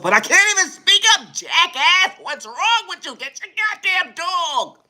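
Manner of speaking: furious yelling. Acted. Yelling at the dog owner to get his dog.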